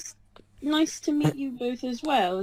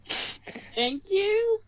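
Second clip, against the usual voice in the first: mock flattered; 9 semitones higher than usual. [name], high pitch.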